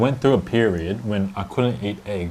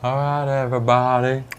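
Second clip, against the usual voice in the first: slow, slightly raised singsong goodbye; put-on sign-off voice; 3 semitones higher, slower than usual. Vlogger signing off to viewers, put-on slow voice. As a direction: stretch out the farewell in a put-on voice. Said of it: "Why are you talking like that?".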